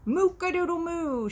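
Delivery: high, loud mock crow with a moo; cow crowing. Cow mocking the rooster, high and loud crowing. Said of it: "she crowed and giggled".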